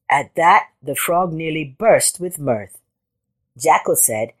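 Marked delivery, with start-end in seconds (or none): none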